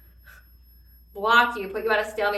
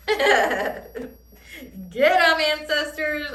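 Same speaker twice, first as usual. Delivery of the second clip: cheering them on through a laugh; gleeful laughing rallying cry; 5 semitones higher, 4 dB louder, much slower than usual. Real, not acted. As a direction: laugh out a gleeful get 'em.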